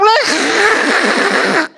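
imitated long, high, harsh throat rasp; mock guttural sound held out for emphasis; exaggerated throat-clearing noise, imitating mom; emphatic throat noise. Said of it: "putting an emphasis on something".

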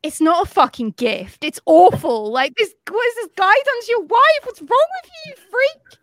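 loud rant climbing high in pitch; exasperated, escalating mock tirade; indignant outburst. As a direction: build from firm objection to high, loud outrage. Indignant outburst answering the husband.

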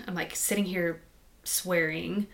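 normal talking voice

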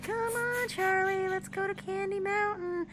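high, singsong coaxing; piping, singsong unicorn; cartoon unicorns. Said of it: "It's these unicorns".

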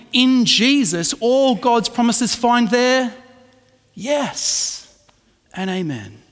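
slow, emphatic reading of the verse; scripture reading. Acted. Preacher reading Corinthians to the congregation, slow. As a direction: lift the verse slowly and solemnly.

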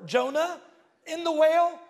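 triumphant question. Retold or imitated. Girl's high triumphant question.

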